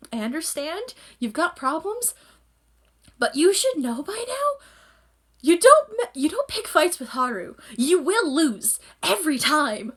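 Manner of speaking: high, animated telling-off; mock warning. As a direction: lecture an imagined troublemaker, half laughing. Mock lecture warning Akito about Haru.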